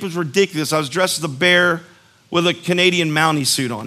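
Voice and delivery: ordinary delivery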